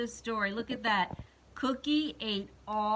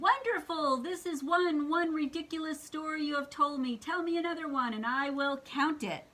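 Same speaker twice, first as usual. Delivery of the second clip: higher-pitched, delighted Count voice; storybook Count, pitched up; Count character voice; 7 semitones higher than usual. Acted. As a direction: read it as the Count with raised pitch. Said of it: "says the Count".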